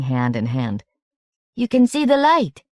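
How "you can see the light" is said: put-on high, bright child's voice; small boy, chiming. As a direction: chime it like an eager little boy. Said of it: "chimed one".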